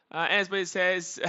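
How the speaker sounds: ordinary delivery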